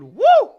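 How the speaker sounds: celebratory woo; excited whoop. Real, not acted. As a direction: let out a thrilled woo. Reacting to the finish.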